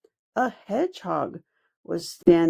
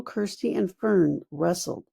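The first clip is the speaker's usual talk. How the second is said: ordinary delivery, nothing marked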